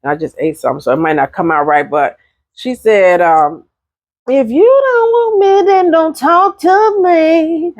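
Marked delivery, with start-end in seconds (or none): 4.3-7.7 squeaky, much higher, slow sung-out line; high squeaky diva impression, drawn out. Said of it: "that didn't sound like [name]".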